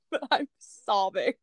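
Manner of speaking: usual speaking voice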